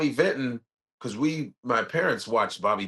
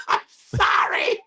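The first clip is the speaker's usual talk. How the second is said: mock sobbing apology; 4 dB louder, much slower than usual. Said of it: "retold punchline again".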